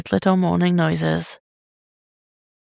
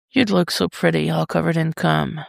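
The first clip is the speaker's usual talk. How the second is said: ordinary delivery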